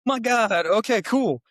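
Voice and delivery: high, loud astonishment; retold shock covered with agreement; stunned reaction. Acting out shock at gorilla news.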